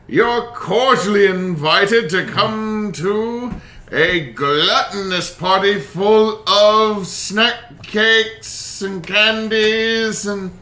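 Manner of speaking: high, slow, grand invitation; monkey butler voice. Acted. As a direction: announce it like a pompous herald. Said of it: "it's like".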